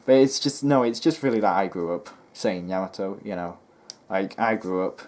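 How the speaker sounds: ordinary talk, nothing marked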